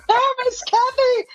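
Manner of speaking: high, sing-song greeting; calling out. Real, not acted. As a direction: greet her brightly from afar. Greeting Kathy nearby.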